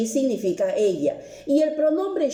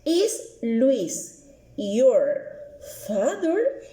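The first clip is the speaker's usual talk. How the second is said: slow model question; much slower than usual. Shown as a demonstration. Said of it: "coloco is".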